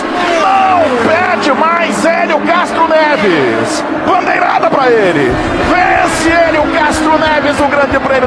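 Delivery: slow, stretched victory shout; shouting the win. Real, not acted. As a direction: yell the winner home. Race winner crossing line.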